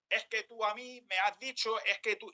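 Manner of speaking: high, pointed blaming tone; accusing. Retold or imitated. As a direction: jab the finger with your voice. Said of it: "yo utilizo el dedo y empiezo a señalar".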